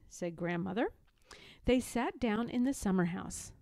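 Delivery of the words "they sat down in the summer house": ordinary delivery, nothing marked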